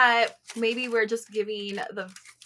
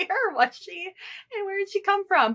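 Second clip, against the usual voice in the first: retold, much higher, disbelieving; stunned relief; 11 semitones higher than usual. Dog owner retelling the moment the dog trotted home, disbelieving. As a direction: squeak out the shock of seeing her. Dog reappears after panic.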